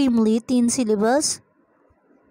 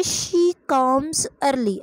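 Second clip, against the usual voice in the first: slow dictation; much slower than usual. Shown as a demonstration. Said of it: "तो कैसे".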